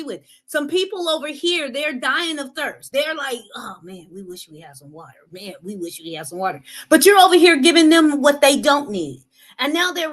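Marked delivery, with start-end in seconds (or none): 3.5-6.6 much softer, a faint parched sigh; quiet, fast, weary wishing for water; weak thirsty voice. Retold or imitated. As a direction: sound drained and parched. People dying of thirst voiced.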